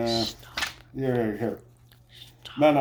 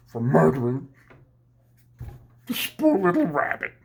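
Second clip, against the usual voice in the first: higher and louder, pushed up into a strained cry; mock outrage burst; 5 semitones higher, 5 dB louder than usual. Gang boss erupts over the murdered rabbit, hammed-up. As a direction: fake fury over the poor rabbit. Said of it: "my favorite impression of, Daniel Day-Lewis".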